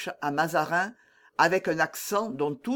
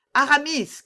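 raised, higher and louder call; narrator's contained cry, pitched up; 9 semitones higher, 5 dB louder than usual. A narrator's reading. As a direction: call out the name to someone outside. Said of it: "cria-t-il".

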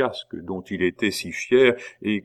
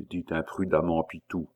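plain reading voice

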